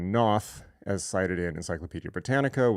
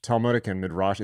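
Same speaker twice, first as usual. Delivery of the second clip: usual speaking voice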